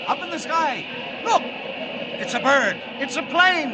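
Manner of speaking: high, loud excited calls; excited shouting. Acted. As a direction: announce the sight in the sky. Show opening, crowd cries out.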